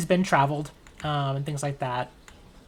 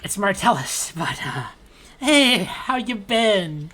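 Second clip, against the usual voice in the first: higher, louder jittery friendliness; nervous friend; 7 semitones higher, 5 dB louder, slower than usual. Acted. Marty nervously greeting armed Sieg, high and jittery. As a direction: cover nerves with cheer. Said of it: "he's nervously laughing".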